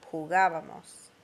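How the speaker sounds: jugábamos with the stress clearly on gá. Shown as a demonstration. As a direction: hit the gá in jugábamos. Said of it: "Notice the accent on the nosotros form".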